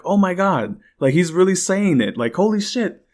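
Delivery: high, loud shocked outburst; shocked disbelief. Real, not acted. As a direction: blurt it out in real shock. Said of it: "I'm like".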